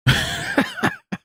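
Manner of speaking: high-pitched giggle. Real, not acted. Exasperated at the clip.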